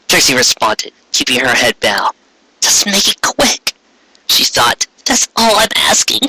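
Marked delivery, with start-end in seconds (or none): 2.6-6.2 higher voice, slow, giving up; Trixie's resigned thought. Acted. Unicorn thinking to herself before the queen, resigned. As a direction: beg for a quick end in your head.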